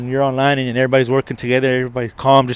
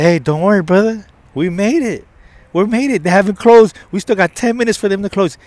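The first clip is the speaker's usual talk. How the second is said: high, loud, urgent encouragement; rally a worried brother, voice lifted; acted-out upbeat pep talk; excited reassurance; 8 semitones higher, 6 dB louder than usual. Cheering up an upset man.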